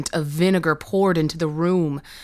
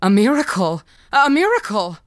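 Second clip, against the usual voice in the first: exclaimed higher and louder, climbing to a cry; building amazement; 4 semitones higher, 5 dB louder than usual. Acted. Bishop's men proclaim the miracle, rising excited cries. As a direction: let the amazement swell into a high cry.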